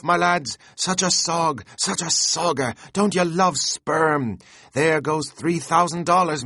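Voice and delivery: high, loud, giddy pleading to the rowers; Flask excitedly urging. A narrator's reading. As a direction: beg the crew to pull, hopping with glee.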